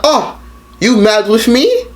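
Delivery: high, slow, fake-innocent mom voice; mock innocent mom. Mom pretending not to know why she's upset, high and slow. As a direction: play dumb in a high sing-song. Mother voiced in retold call.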